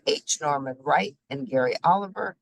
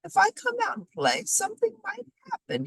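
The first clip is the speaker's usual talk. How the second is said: mournful; faster than usual. A narrator's reading. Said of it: "he said mournfully".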